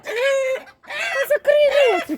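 high, slow drawn-out yell at the birds; scolding shout. Real, not acted. Woman scolding squawking chickens, high drawn-out shout. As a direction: tell the noisy flock to shut up.

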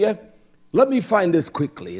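usual speaking voice

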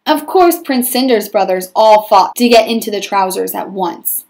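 lively read-aloud storytelling voice; story narration. A narrator's reading. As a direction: read it like a picture book to a class. Teacher reads story aloud.

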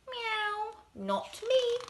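playful high kitty denying it; cat voice. Acted. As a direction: mew it like a cat. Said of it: "meowed the cat".

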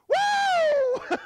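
high, loud cheering whoop; crowd whoop. Retold or imitated. Crowd cheering Jesus' entry.

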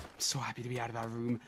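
near-whispered, low relief; very soft, spent murmur; drained relief. Real, not acted. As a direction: whisper it, worn out. Exhausted relief after hard room.

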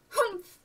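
high-pitched disapproving huff; indignant humph from a passer-by; huffy humph. A narrator's reading. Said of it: "said a woman pushing past".

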